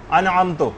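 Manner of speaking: انعمتو ending on a u vowel instead of the correct i; wrong vowel. Shown as a demonstration. Teacher showing students the wrong vowel in انعمتو. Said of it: "یہ بھی غلط ہے".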